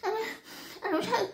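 comic near-weeping; holding back tears. Pretend. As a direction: play fighting off a cry, half laughing. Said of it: "مش عايزه اعيط".

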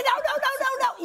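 high panicked squeals of no; frantic high-pitched protest. Retold or imitated. Panicked protest on the waxing table.

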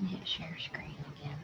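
whispered to herself. Real, not acted. Fumbling with screen sharing, muttering in a whisper. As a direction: mutter instructions under your breath.

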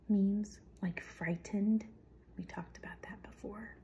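ordinary delivery

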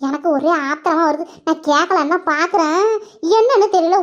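ordinary talk

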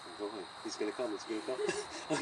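droning mock monotone, low energy. Shown as a demonstration. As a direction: imitate a listless, going-through-the-motions student. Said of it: "you're monotoning on me".

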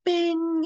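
sudden high bright bing. Retold or imitated. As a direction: pop up like a jolt of caffeine. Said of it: "I'm like".

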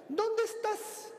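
high, slow, suspicious wife voice; high nagging wife demanding where he is; jealous wife. Retold or imitated. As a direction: grill him like a jealous wife.

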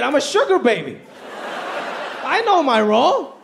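ordinary delivery

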